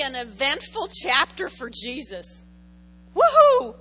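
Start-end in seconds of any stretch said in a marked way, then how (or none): 3.1-3.7 loud high whoop of joy; excited whoop. Real, not acted. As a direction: cheer it out loud. Excited cheer in sermon.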